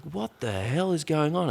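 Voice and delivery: high and slow, stunned disbelief; bewildered. Retold or imitated. Woman watching police storm the train, stunned and bewildered. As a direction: ask it like the world just flipped.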